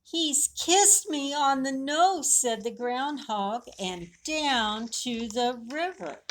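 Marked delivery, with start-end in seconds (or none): 0.0-2.3 high, lightly voiced puzzled lamb. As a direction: wonder aloud, a little amazed. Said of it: "the lammy says".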